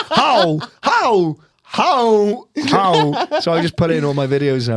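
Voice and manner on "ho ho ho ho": high, spaced-out exclamations. Acted. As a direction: throw out each cry high, pausing between. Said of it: "He was going".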